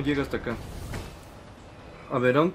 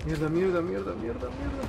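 cursing under breath; 5 semitones higher, 6 dB quieter than usual. Real, not acted. Chased by Nemesis, swearing.

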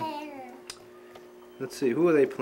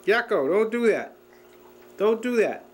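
mild scolding; 7 semitones higher than usual. Real, not acted. Father warns the baby not to grind his teeth, higher and firmer.

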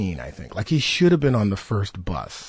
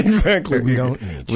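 laughing; 3 semitones higher, 5 dB louder than usual. Real, not acted. Laughing at the story.

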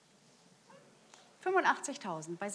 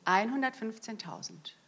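ordinary talk

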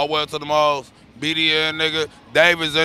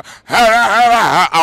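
loud stoned laugh; 5 semitones higher, 6 dB louder than usual. Real, not acted. Stoned giggle fit.

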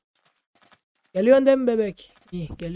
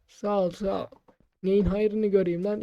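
normal talking voice

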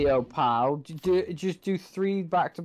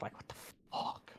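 breathy whisper of disbelief, much softer; 15 dB quieter, faster than usual. Real, not acted. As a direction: hiss it under your breath in disbelief. Frustrated gamer mutters under breath.

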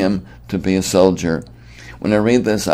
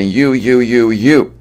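loud, higher-pitched Roman officer picking out recruits; recruiting centurion; 4 semitones higher, 6 dB louder, slower than usual. Acted. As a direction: call out each YOU like pointing at conscripts. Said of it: "go into a city and say".

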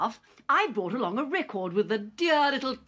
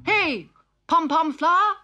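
high, loud robot shout; 10 semitones higher, 6 dB louder than usual. Acted. Digby calls to the walking flower, high loud shout. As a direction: call it out across the field. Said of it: "shouted Digby".

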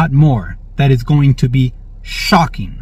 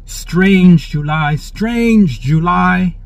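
drawn-out prophetic quote; 4 semitones higher, much slower than usual. Retold or imitated. Quoting a prophetic word.

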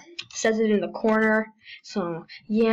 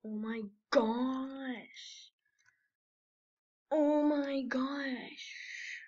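awed; 3 semitones higher, 10 dB quieter, much slower than usual. Real, not acted. Drawn-out amazement.

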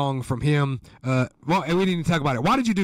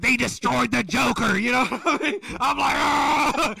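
mock outraged; 11 semitones higher than usual. Host retelling his reaction to the film, high outraged yell.

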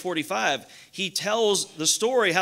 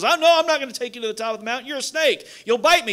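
high, loud mock protest; alarmed refusal; 5 semitones higher, 6 dB louder, faster than usual. Climber turning down a poisonous snake, acted alarmed refusal. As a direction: refuse in a rush, pitched up and indignant.

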